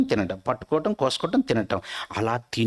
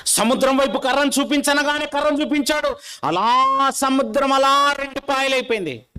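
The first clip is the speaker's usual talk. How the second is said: raised, ringing preacher's voice, higher and louder; preaching at full force; 12 semitones higher, 3 dB louder than usual. Acted. Preacher picturing the crowd for his listeners, ringing and raised. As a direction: proclaim it to the whole congregation. Preacher proclaims the sea parting.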